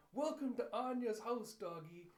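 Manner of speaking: put-on high, loud little-girl greeting; excited child welcome. Child welcomes the doggy home, loud excited greeting. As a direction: announce it like a delighted kid.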